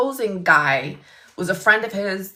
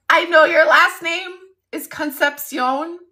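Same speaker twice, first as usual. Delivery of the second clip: high, giggly delivery; laughing punchline; 8 semitones higher, 6 dB louder than usual. Real, not acted. Client renaming his lawyer, retold high and laughing. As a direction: barely get the punchline out for laughing.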